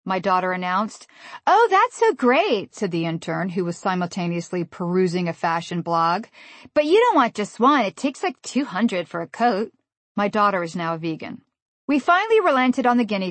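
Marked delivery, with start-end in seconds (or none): 1.5-2.8 high, slow, airy fake enthusiasm; gushing intern. 6.7-9.7 airhead intern. Retold or imitated. Intern continues explaining.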